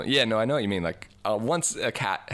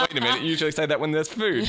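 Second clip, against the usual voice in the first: higher, fast mock-puzzled cat voice; confused cat; 7 semitones higher, 3 dB louder, faster than usual. Cat realizing the food call was a trick, mock puzzled. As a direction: play the tricked cat catching on. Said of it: "they're like".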